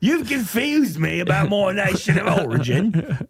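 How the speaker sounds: high, indignant muddled Aussie-English; wavering British-Australian accent. Acted. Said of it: "swinging back and forth".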